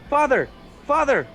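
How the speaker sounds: high, loud cry for Father; hollering for help. Acted. Bewildered nun calls for the priest, hollering. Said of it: "hollering and trying to go up".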